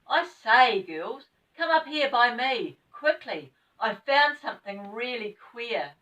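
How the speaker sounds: loud, high excited call; shouting down to the girls. Acted. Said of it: "he gave a shout".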